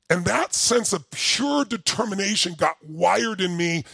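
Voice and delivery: ordinary talk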